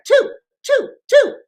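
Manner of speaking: "Two" punched high and loud each time; emphatic repeat. Real, not acted. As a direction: hammer "Two" proudly. Stressing the number of trips.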